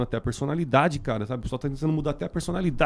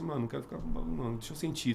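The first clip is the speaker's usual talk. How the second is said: quiet; 12 dB quieter than usual. Retold or imitated. Retold inner thought, calm.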